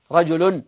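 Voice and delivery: رجل with an audible -un ending; final nun sounded. Shown as a demonstration. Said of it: "لما تقول رجل ما تكتب بعدها".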